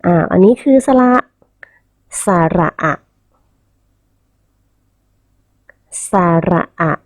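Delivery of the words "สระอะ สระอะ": สระอะ in separated syllables, short final อะ; model pronunciation. Shown as a demonstration. Teacher has children read vowels along, model pronunciation of สระอะ. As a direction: say สระอะ carefully, cutting the last vowel short. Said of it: "เรามาอ่านออกเสียงพร้อมกับคุณครู".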